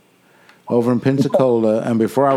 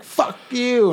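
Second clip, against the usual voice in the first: mock-angry, high-pitched outburst; angry drunk retort; 10 semitones higher than usual. Friend retells drunk Courtney lashing out, mock angry. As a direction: throw it out high like a belligerent drunk. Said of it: "he just starts getting angry".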